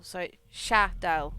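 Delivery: Shadel sounded out slowly, its first a as in apple; slow careful Shadel. Shown as a demonstration. Streamer sounding out the word for chat, slow and careful. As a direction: spell Shadel out slowly by ear. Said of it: "Like apple".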